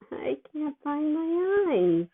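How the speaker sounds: slow, high, spaced-out voice; dopey and drugged. Acted. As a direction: sound loopy on pain meds.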